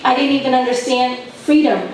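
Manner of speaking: usual speaking voice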